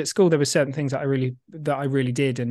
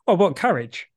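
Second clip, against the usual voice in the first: usual speaking voice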